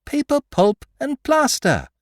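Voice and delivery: very high, loud repeat; triumphant cry. A narrator's reading. Beautrelet repeating to the magistrate, triumphant cry. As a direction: hammer the word home. Exposing the fakes.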